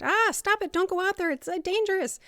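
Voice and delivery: high, loud frantic warning; panicked madwoman. Acted. Madwoman trying to protect someone from danger, panicked. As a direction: cry out in alarm, pitch jumping up. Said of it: "imagine you're this madwoman trying to protect".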